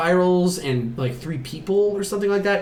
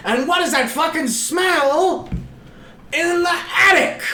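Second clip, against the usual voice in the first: exasperated yell; 13 semitones higher, 3 dB louder than usual. Retold or imitated. Mother noticing a smell upstairs, exasperated. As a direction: build to a hammed-up shout.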